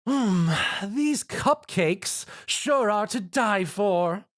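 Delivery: high, slow, exaggerated bait line; odd intonation. A narrator's reading. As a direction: overact to lure the monster. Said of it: "Rarity exclaimed with an odd intonation".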